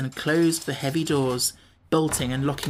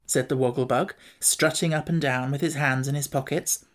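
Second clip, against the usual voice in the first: ordinary delivery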